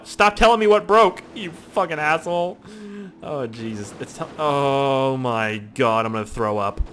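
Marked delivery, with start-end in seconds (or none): 0.0-2.6 loud, higher mock snap; mock anger. Gamer snapping at the game, mock angry.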